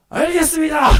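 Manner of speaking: high, loud soldier's acknowledgement; archangel's shout. Acted. Archangel answers the Lord's order, soldierly shout. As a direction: shout back like an obedient officer. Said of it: "천사장의 소리".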